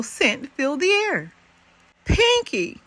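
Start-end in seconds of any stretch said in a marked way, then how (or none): 2.0-2.8 high surprised call of Pinky!; cried out. Acted. Hedgehog seeing the skunk rescue him, high surprised cry. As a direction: call out to a friend arriving. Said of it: "cried Elmore".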